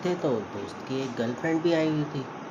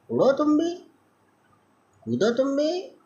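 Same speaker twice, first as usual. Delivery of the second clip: much higher, sing-song mockery; mocking taunt. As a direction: tease her in a squeaky high taunt. Mocking the daddy's princess. (बहुत ऊँची आवाज़ में चिढ़ाते हुए)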